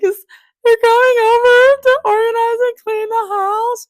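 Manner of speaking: high, loud whiny wail, reliving the tears; mock a tearful complaint; retold crying whine. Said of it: "I was crying".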